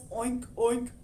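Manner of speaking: grunting oink; pig oink. Acted. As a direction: snort it like a pig. Pig heard as oinking.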